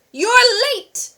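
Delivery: loud, high angry roar; furious chef father roaring. A narrator's reading. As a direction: bellow at the late son. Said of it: "he roared".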